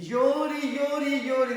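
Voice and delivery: loud, high, insistent repetition; exasperated mimicry. Priest telling of a woman crying through the reading, exasperated.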